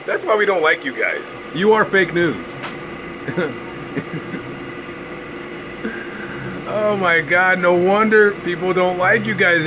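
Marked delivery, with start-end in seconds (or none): 2.5-6.7 drawn-out silent breathy laughter; long wheezing laugh. Real, not acted. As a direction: let a long airy laugh run out. Laughs at the media.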